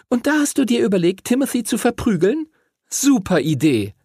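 put-on high, fast girlish outburst; exclaimed sarcastically. A narrator's reading. Lucy mocks Henry's plan, high sarcastic exclaiming. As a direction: cry it out high, rushing into sarcasm. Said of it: "rief Lucy".

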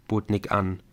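plain reading voice

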